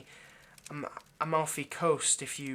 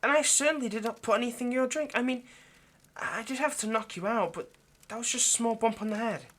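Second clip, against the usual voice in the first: higher and louder, hurried protest; flustered defensiveness; 7 semitones higher, 4 dB louder than usual. Acted. Vampire insists to the captive that he drugged nothing, high and defensive. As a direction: protest your innocence a little too hard.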